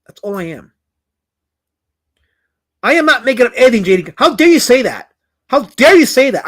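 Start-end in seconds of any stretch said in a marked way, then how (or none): 2.8-6.5 louder, higher hammed-up offence; mock indignation. Streamer scolding chatter J D, mock offended. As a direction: play-act being deeply insulted.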